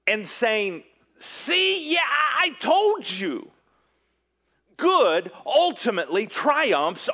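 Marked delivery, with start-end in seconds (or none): 1.4-3.5 high, triumphant gloating, not quite laughing; gloating told-you-so. Retold or imitated. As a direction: crow it like a know-it-all. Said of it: "throwing its head back and laughing".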